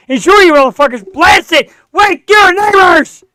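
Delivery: loud, rising hype yell; hype shout. Real, not acted. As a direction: yell it out to pump up the crowd. Host hyping song, yelling out.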